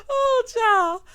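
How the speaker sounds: a squealing real laugh trailing into a sigh; howling laugh. Real, not acted. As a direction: crack up completely, then gasp out the words. Cracking up at her own joke.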